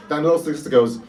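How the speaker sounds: ordinary talk, nothing marked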